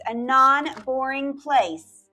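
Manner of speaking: ordinary delivery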